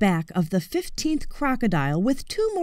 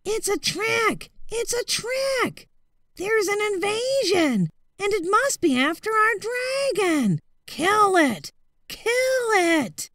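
yelling; 11 semitones higher than usual. A narrator's reading. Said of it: "all yelling".

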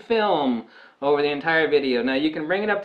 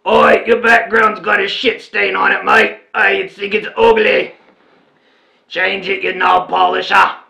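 put-on loud Australian accent, insulting and jeering; hammed-up Australian accent, loud; mock Aussie insult; 6 semitones higher, 10 dB louder than usual. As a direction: bark it like a rude Aussie, laughing at the end. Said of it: "Aussie said".

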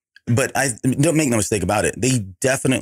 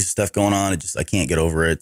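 ordinary talk, nothing marked

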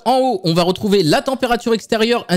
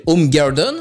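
English name in an attempted English accent; careful English pronunciation; 4 semitones lower, slower than usual. Real, not acted. YouTuber spelling out the app's name, careful English accent. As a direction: have a proud go at an English accent. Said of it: "Je l'ai super bien prononcé celui-là".

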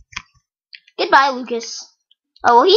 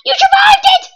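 much higher, louder disbelieving cry; shocked; 6 dB louder than usual. Real, not acted. Gamer seeing the opponent survive, shocked cry. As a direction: yelp it in disbelief.

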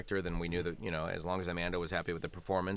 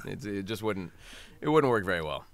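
ordinary talk, nothing marked

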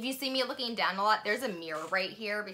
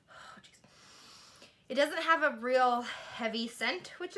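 face covered by sheet mask; talking while adjusting face mask; speaking with sheet mask on. Real, not acted. Seen 0: Wet sheet mask on face throughout; mouth opening leaves lips mostly free.

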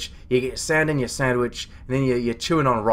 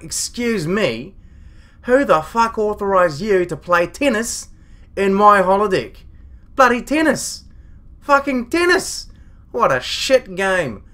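louder, higher, indignant dressing-down; outraged; 5 semitones higher, 4 dB louder than usual. Acted. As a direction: explode in disbelief at the crew. Captain confronting crew playing tennis.

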